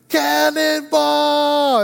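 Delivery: high, loud, long-held shout; kid's cannonball yell. Retold or imitated. Six-year-old jumping into the pool, gleeful cannonball yell. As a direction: yell it like a little boy leaping. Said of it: "he jumps in and yells".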